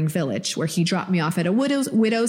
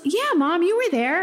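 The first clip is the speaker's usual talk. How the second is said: much higher, childlike imitation of her son; little son's voice; 12 semitones higher than usual. Mom retelling her son's words to podcast cohost, in a high childlike voice. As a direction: pitch way up to play her little boy. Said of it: "He goes".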